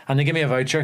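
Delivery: ordinary delivery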